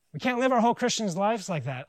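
ordinary talk, nothing marked

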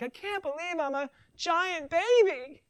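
high, slow mock baby whine; whiny giant baby. Preacher mocks lazy Christians as a whining baby. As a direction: whine like an overgrown toddler.

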